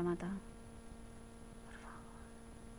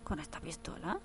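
a hint of a dazed, quieter killer; lightly voiced stunned killer; 8 dB quieter than usual. Voicing Patrick stunned after the explosion, quieter. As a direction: mutter it like you can't believe what you did.

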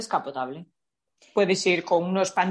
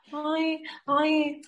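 higher, prissy scandalised voice; mock prudish virgin; 7 semitones higher, much slower than usual. Scandalised date protesting she is a virgin, prissy and high. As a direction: play the shocked innocent. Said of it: "y dice".

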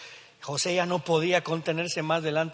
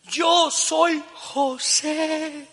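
very slow, high, drawn-out reveal; tearful; 12 semitones higher, 6 dB louder, much slower than usual. Retold or imitated. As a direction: stretch each word through tears. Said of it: "la tercera vez que lloró".

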